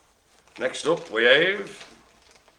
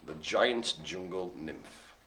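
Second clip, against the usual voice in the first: slow announcer call; 9 semitones lower, 14 dB quieter, slower than usual. Acted.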